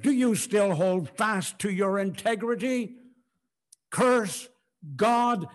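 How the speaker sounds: loud, high challenge as Job's wife; calling out. Acted. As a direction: call it out loud, punching each word. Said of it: "called out to him and said".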